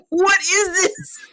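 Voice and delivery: high, pleading mock whine, voice near breaking; mock anguished wail. Viewer mock-wailing at the TV, high and tearful. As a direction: wail it like you can't bear another minute. Exasperated viewer retelling her reaction.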